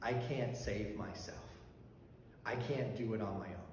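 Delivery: a voice heard inside the head; inner voice. Retold or imitated. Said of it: "the only thing that I heard in my head".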